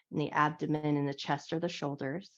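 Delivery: calm, gentle guiding voice through each stretch; guided yoga instruction. Acted. Yoga teacher guiding her class through a wake-up stretch, calm guided voice.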